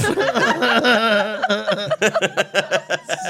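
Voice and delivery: big high laugh; loud laugh. Real, not acted. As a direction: burst out laughing. Laughing at the retold joke.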